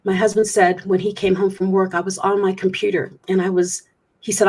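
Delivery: usual speaking voice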